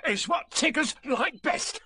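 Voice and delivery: very high, slow, gleeful creature voice; Tigger. A narrator's reading. Tiger boasting of his favourite food, high and gleeful. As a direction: stretch it out high, ending in a laugh. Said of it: "said Tigger".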